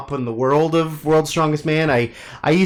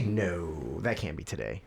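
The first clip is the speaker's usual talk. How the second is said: low, soft disbelieving mutter; muttering to self; 8 semitones lower, 12 dB quieter than usual. Real, not acted. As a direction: mutter it under your breath.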